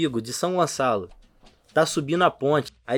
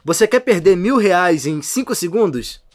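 hammed-up internet ad hook; YouTube ad guy; 4 dB louder than usual. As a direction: imitate an annoying online pitchman hooking the viewer. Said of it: "aí o cara tá assim, falando".

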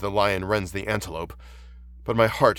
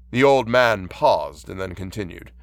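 ordinary delivery

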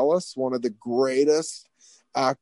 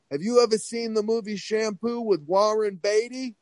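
high imitated Long Island voice; eager mock Long Island question, pitched up; Harvey Fierstein; 7 semitones higher than usual. School boss quizzing the teenager about a movie, Fierstein impression. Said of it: "sounded like Harvey Fierstein".

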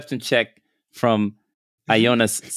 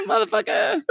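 laughing. Real, not acted. Cracking up.

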